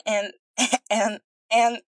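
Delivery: the princess can't stop laughing mid-sentence; laughing fit. A narrator's reading. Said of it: "a fit of laughter checked her".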